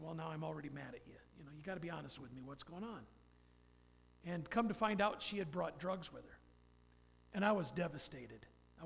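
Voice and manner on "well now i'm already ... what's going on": fast, softer, pressing; quick retold scolding. Man retelling confronting girlfriend, fast soft pressing. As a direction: rush the demand for honesty. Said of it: "I said".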